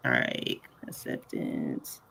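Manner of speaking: whispered under the breath; whispering. Real, not acted. Whispered aside to herself.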